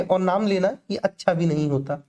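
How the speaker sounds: ordinary talk, nothing marked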